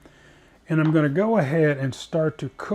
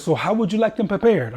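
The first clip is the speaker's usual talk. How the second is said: hammed-up Italian waiter, higher pitch; lousy Italian accent; 5 semitones higher, 4 dB louder than usual. Italian server asking a diner about porcinis, lousy Italian accent. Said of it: "I'm doing a lousy Italian accent".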